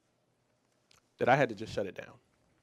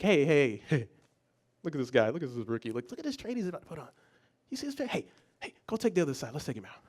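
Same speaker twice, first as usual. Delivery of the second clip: mocking traders; 5 dB quieter than usual. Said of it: "the guys were like".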